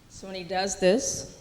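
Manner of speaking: ordinary talk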